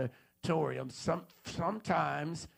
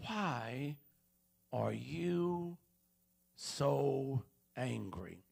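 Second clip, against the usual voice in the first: words separated by long pauses, softer; slow spaced question; 2 semitones lower, 5 dB quieter, much slower than usual. Acted. Preacher pressing the audience, slow and spaced. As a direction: drag it out word by word.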